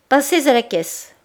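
loud, high and cold; terrible verdict. A narrator's reading. Madame Aurélie firing Denise, loud and final. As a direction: pronounce the dismissal. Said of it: "La terrible phrase sonna très haut".

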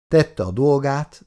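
ordinary delivery, nothing marked

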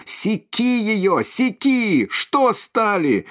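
very high, slow, frenzied yelling; frenzied crowd shouts. Acted. Voices from the crowd urging the flogging, a high frenzied yell. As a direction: egg him on in a frenzy, pitched high. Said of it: "кричат голоса из толпы".

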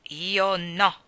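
put-on mild stubborn boy's retort; firm retort. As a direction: shut it down with a firm no. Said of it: "ribatté decisamente Davy".

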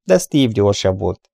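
ordinary delivery, nothing marked

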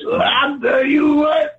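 high, drawn-out, hammed-up celebrity impression; Bill Cosby. Riffing on dad's Bill Cosby quotes, hammed-up impression. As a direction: stretch it out high in a bad comic impersonation. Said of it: "I can't do Bill Cosby".

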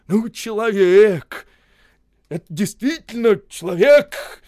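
loud, high, slow amazement; marvelling. A narrator's reading. Mordvin marvelling aloud at Osip's daring. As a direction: exclaim in awe, drawn out.

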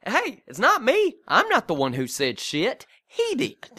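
hammed-up pointing the finger at dad; mock indignant mom. Wife deflects blame onto her husband in a mock indignant voice.

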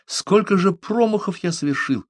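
high-pitched inner lament; self-doubting exclamation. A narrator's reading. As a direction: lament your own blunders to yourself. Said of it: "усомнился он в душе". (сокрушённо, про себя)

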